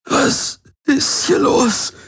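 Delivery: halting, high, breathy strain; agonized. A narrator's reading. Garland begs the Helix for answers, in agony. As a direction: force out each word slowly through pain. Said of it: "Jedes Wort war eine Qual".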